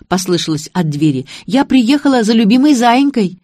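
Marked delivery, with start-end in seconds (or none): 1.5-3.4 high, louder affectionate hail; fond calling out. A narrator's reading. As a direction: call to a darling from the door. Sister calls fondly from the door.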